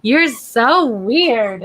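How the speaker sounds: stretched-out "You're so weird"; slow playful tease. Real, not acted. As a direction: drawl it fondly. Slow teasing to dog.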